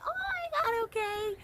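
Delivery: very high, squealed oh my God, okay; thrilled. Retold or imitated. Fans agreeing to the dance, very high and giddy. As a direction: gasp then agree, giddy.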